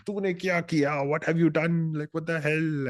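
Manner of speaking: high-pitched retold scolding; mock scolding. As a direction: imitate an outraged father. Father slapping and scolding son.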